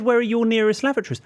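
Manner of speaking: high, loud mocking echo of the question; mimicking her. Shop lady's question echoed in a high mocking voice. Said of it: "She said".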